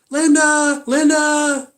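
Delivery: high, loud mock call. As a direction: call the name out loud like she did. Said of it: "let's just say her name's Linda".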